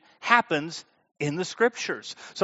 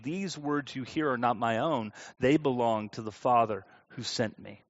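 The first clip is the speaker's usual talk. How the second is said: measured, lower reading of scripture aloud; 5 semitones lower than usual. Acted. Pastor reading John to the congregation, measured and reverent.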